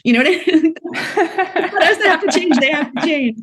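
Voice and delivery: incredulous laugh. Real, not acted. Founder insisting men must change, laughing.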